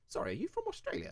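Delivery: high, soft, quick tentative question; imitated curious stranger. Stranger stopping him in a shop, politely curious. Acts a stranger asking in shops.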